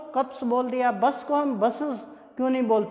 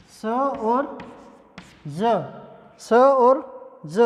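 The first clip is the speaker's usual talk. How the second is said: slow teacher demo of स and ज; drawn-out sound demo; much slower than usual. Shown as a demonstration. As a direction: stretch स and ज very slowly.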